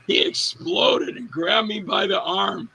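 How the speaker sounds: usual speaking voice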